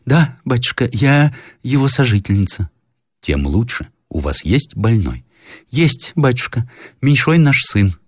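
lightly voiced worried old woman. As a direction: play the timid landlady answering the officer.

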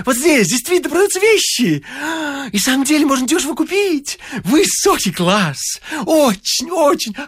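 very high, excitable bleat. A narrator's reading. Bouncing stranger delighted to find an auction, high bleating. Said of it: "Беспрерывно блея".

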